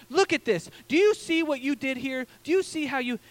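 mock a dentist talking down to a patient; scolding dentist. Dentist lecturing him about his teeth, mildly scolding. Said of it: "telling me how terrible my teeth are".

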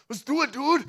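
high, excited imitation of a passionate German buddy; passionate German friend. German friend agreeing to a half marathon, hammed-up excitement. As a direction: jump in with hammed-up eagerness. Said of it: "this German dude who's just passionate".

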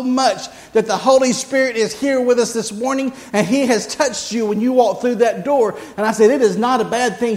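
rising preaching. Acted. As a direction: build it up for the church. Preaching the story, building.

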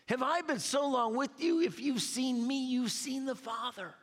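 high, intense pulpit voice, pressing every line; preaching at full force. Acted. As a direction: preach it with fire to the congregation. Preacher quoting scripture, high, intense.

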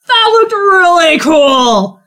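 high, slow impressed voice; slow awed drawl. Acted. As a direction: say it slow and amazed. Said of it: "you hear [name] go".

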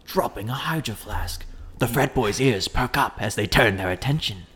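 gravelly Aussie wildlife narrator; Australian documentary voice. Shown as a demonstration. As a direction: narrate a hunt in an Australian accent. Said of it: "the documentary voice when I do Australian".

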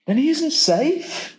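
put-on high, worried child's voice; Lucy fretting that he isn't safe; little girl Lucy. A narrator's reading. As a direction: voice Lucy as a small anxious girl. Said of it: "said Lucy".

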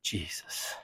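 a reverent whisper fading out; whispered thanks. Shown as a demonstration. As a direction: breathe the words to Jesus in a whisper. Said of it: "everybody in the room should say".

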